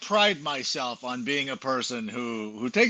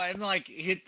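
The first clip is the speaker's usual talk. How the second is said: normal talking voice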